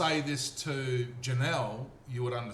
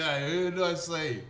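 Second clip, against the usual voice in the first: slow, higher mock-baffled question; puzzled question; 4 semitones higher, 4 dB louder, slower than usual. Husband asks wife who he saw, baffled. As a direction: ask it half laughing, baffled. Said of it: "I go".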